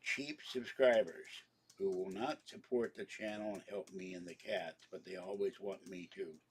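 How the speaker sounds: ordinary talk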